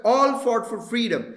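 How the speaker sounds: emphasis landing on 'all'; stress on ALL. Shown as a demonstration. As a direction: hit 'all' hard, then carry through 'freedom'. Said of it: "अब मेरा stress है".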